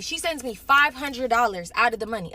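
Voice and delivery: normal talking voice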